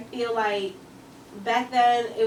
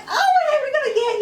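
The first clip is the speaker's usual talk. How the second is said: high, loud imitation of eager interviewers; mock excitement; 8 dB louder than usual. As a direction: gush it as delighted interviewers, with a laugh. Interviewers thrilled by Irish name, retold.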